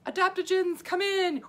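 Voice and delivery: high-pitched cartoon rescue call; rescuing adaptogens. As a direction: swoop in high like a tiny hero. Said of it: "here's my impression of the body under stress".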